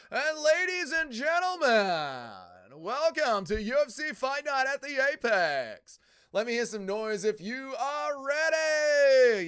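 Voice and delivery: big drawn-out boxing ring announcer, high and loud; slow, booming arena intro. Retold or imitated. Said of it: "he's got the worst ring announcer voice".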